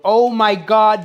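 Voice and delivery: slow, loud, awed oh my God; awed sigh. Real, not acted. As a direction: gasp it out in awe. Opens the dish after twelve hours.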